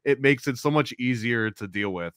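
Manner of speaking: ordinary talk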